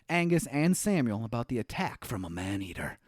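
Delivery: normal talking voice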